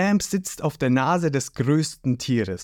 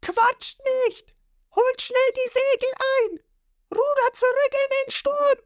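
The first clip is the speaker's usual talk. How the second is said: Nami yelling orders. A narrator's reading. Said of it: "brüllt Nami los".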